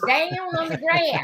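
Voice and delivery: slow Alabama drawl on grass; Southern drawl. Retold or imitated. Mother repeating son's Southern phrase. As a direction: drawl the diphthong like a small boy. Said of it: "he said it the same way".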